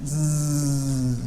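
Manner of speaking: soft, long voiced buzz; buzzing zzz. Shown as a demonstration. Teacher shows the continuous z sound. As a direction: hum the zzz steadily. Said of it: "over and over and over again".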